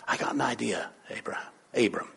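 hushed, low, conspiratorial whisper; scheming whisper. Acted. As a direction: whisper a secret plan to your husband. Sarah secretly pitching her plan.

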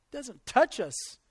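ordinary delivery

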